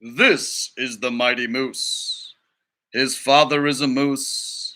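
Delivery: slow, grand documentary voice; nature documentary narrator. Acted. Teacher reading to students as the documentary narrator, slow and grand. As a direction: narrate the mighty moose like a wildlife film.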